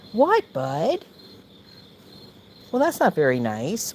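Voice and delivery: high sweet voice to a cat; pet talk. Real, not acted. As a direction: coo at the cat. Talking to the cat.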